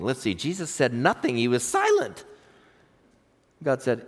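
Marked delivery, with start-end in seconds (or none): none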